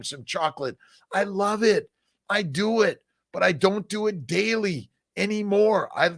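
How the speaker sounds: ordinary delivery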